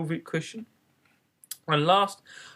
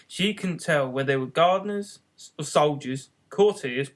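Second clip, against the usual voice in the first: ordinary delivery, nothing marked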